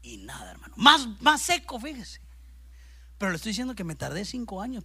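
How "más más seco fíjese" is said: ordinary talk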